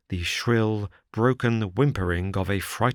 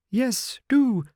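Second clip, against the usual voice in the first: much higher, begging nervous voice; pleading. A narrator's reading. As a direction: beg them to come in. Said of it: "pleaded Thaddeus Sholto".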